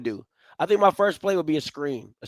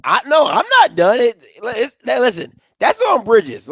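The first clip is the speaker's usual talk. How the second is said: worked up; 8 semitones higher, 8 dB louder than usual. Real, not acted. Heated rant about the channel.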